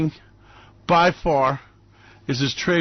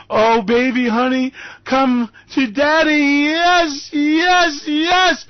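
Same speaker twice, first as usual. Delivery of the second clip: much higher, louder yes yes yes; hammed-up rapture; mock ecstasy; 8 semitones higher, 4 dB louder, much slower than usual. Mock ecstasy over market.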